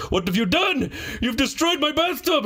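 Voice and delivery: high hammed-up wail with a laugh; mock wailing landlord. Landlord blaming the tenant, high laughing wail. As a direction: wail it like a silly victim.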